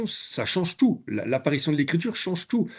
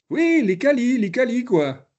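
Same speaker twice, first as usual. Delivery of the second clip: much higher and louder, an eager lilt; Algerian French accent; 10 semitones higher, 4 dB louder, slower than usual. Retold or imitated. The trainer voices the learner confirming the word, in an Algerian accent. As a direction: brightly confirm it in his accent. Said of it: "je vous le fais avec l'accent".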